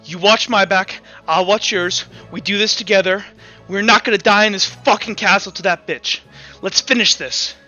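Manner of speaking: rally your partner for the fight; urgent rallying ally. Acted. Woman urging the caretaker not to die, fierce resolve.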